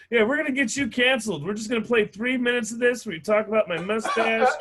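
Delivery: high, loud taunting voice, laughing. Retold or imitated. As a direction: gloat like you're about to cancel him.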